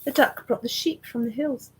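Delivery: ordinary delivery, nothing marked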